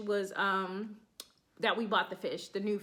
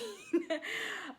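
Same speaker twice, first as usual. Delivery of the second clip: a real, high burst of cackling; real high-pitched cackle; 10 semitones higher than usual. Real, not acted. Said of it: "I just freaking cackled".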